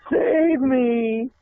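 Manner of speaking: high, shrill-free plea, mock nun crying out; desperate cry for help. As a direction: cry it out as the fainting nun begging to be saved. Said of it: "and she says".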